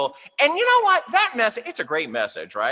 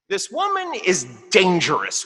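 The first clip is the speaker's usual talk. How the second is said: ordinary talk, nothing marked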